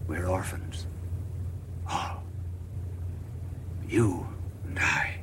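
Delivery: Christ grieving, voice sinking low and soft; tearful, low and hushed. Acted. Christ tells the children they are orphans, low and tearful. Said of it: "answered with tears streaming down".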